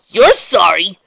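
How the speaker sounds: high, loud disbelieving question; incredulous. A narrator's reading. Raindrops pushes Trixie away, incredulous. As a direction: throw the apology back in disbelief.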